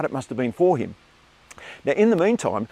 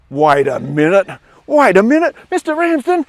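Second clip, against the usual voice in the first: surprised realisation; 7 semitones higher, 9 dB louder than usual. Retold or imitated. Boy realises the trick.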